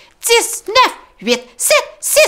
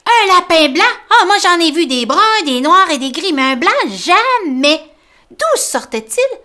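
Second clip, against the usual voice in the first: high, loud wide-eyed mole; Tom, amazed; 7 semitones higher, 7 dB louder than usual. Acted. Tom telling Grumbo about the rabbit, high amazed voice.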